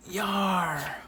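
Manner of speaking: high mock cry of pain; Cloak. Cloak reacting to the bite, high mock yell. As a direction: yelp it in a put-on voice. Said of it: "he's like".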